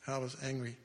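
ordinary talk

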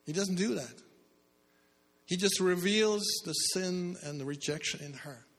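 preaching fervently. Acted. Preacher telling the church Jesus reveals sin, fervent. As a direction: keep the sermon's heat.

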